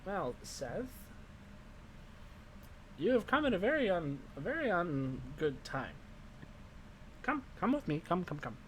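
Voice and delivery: lightly voiced old crone, coaxing; wizened old crone. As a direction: beckon him in like a sly old witch. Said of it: "wizened old crone voice".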